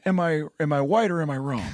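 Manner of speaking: a light take on Joe Biden's voice; hint of an old president's halting voice; Joe Biden impression. Comedian pitching a special, lightly as an old politician. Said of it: "I wanna do a special as Joe Biden".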